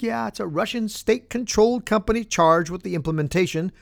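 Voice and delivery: ordinary delivery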